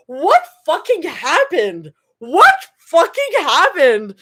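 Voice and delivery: high, slow, stunned outcry; shocked disbelief. Real, not acted. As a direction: gasp it in disbelief. Reader reacts in disbelief.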